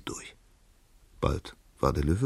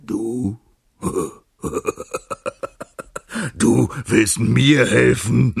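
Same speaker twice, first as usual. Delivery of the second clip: higher, incredulous laughing lion; hearty laughter; 6 semitones higher, 7 dB louder, much slower than usual. A narrator's reading. Lion laughing heartily at the mouse's offer of help. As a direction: burst into big laughs between the words. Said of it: "musste der Löwe herzlich lachen".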